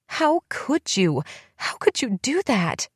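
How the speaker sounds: high, slow, tearful accusation; crying hard. A narrator's reading. Elizabeth crying at her twin, heartbroken. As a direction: sob the question out. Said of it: "She was crying so hard".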